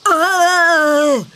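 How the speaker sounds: mock grunt of an ox; imitated ox grunt. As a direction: grunt like a big ox. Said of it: "and it goes".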